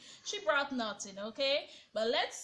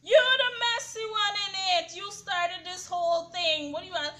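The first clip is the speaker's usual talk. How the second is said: loud, high mock outburst at Eva; mock angry shout; 7 semitones higher, 9 dB louder than usual. Retelling Will snapping back at Eva, loud and high. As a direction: fire back like a man accused.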